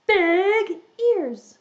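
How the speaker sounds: "big" pitched high and punched; stress on big. Shown as a demonstration. Teacher describes elephant to children, "big" high and emphatic. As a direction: hit "big" high, then let "ears" fall.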